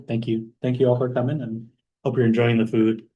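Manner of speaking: usual speaking voice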